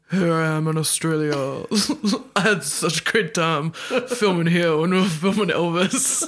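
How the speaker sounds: a hint of the [name] voice as a giggling send-up; mock [name] press-tour voice, half laughing. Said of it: "still using the [name] voice".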